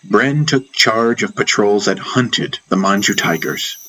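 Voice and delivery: ordinary talk, nothing marked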